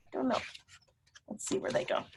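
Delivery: usual speaking voice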